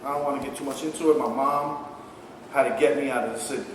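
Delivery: ordinary talk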